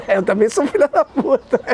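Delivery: laughs through the punchline; laughing. Real, not acted. Telling viewers he can be tough too, laughing. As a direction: chuckle it out.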